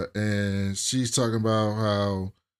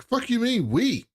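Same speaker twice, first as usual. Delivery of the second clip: indignant retort; 12 semitones higher than usual. Retold or imitated. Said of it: "And Rob's like".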